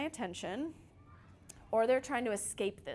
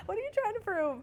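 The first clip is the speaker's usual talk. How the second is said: high-pitched mock challenge; challenging retort; 12 semitones higher, 3 dB louder than usual. Mom answers child's bluff about packing the car, challenging. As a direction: throw it back, pitched way up.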